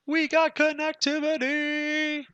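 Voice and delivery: high, drawn-out happy call; excited sing-song. Real, not acted. Teacher celebrating working connectivity to the viewers, excited sing-song. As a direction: sing it out when the ping works. Ping test finally succeeds.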